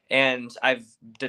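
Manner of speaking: ordinary talk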